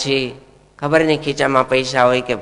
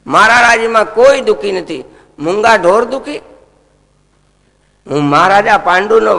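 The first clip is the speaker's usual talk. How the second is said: higher and louder, an outraged ruler; angry king; 4 semitones higher, 4 dB louder than usual. Acted. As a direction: thunder like a king defending his animals. King Parikshit rages at the cow-beater.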